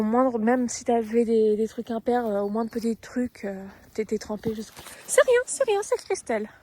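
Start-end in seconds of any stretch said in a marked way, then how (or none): 5.1-6.5 sudden high loud startled reassurance. Real, not acted. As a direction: blurt it out in a fright, high and loud. Rider startled, calming the horse.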